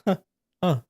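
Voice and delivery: small breathy huh huh; demo of a tiny laugh. Shown as a demonstration. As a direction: show the smallest possible laugh. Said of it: "a little bit".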